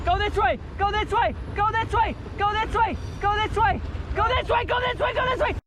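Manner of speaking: high yelled directions; calling from far away. Real, not acted. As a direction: shout instructions across the water. Calling directions across the river.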